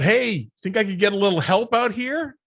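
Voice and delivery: mock yell from the snow for help; retold yell for help. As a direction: shout it from the ground to the bus. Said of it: "and I yell out".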